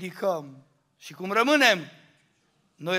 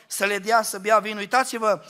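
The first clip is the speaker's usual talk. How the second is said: ordinary talk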